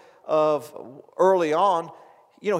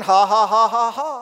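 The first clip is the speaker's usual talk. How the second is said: loud, deliberate run of "ha"s; dry forced laugh; 2 semitones higher, 8 dB louder than usual. Retold or imitated. Preacher imitates laughing through chest pain, dry forced laugh. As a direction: push out laughter without feeling joy. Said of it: "Still no feelings".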